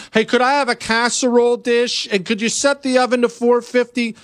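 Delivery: mocking Chris's request. Mocking Chris asking a host for the oven, in a high whiny voice. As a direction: ask for the casserole dish in a mock-polite voice. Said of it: "are you gonna borrow the oven".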